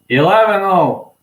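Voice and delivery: high, loud proud call; triumphant shout; excited cheer. Real, not acted. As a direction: cheer the perfect record. Cheering friend's record.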